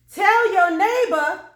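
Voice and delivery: high, very loud, stretched call; preacher's call. Acted. Preacher telling congregation to turn to neighbors, loud call. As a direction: boom it out to the whole church.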